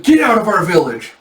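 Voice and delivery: high, loud acted order; angry kid's yell. Little kid ordering Taejun out of the village, angry. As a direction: shout the stranger away.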